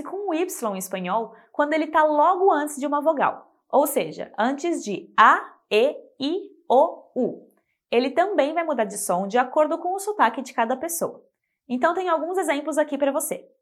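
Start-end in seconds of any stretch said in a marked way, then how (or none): none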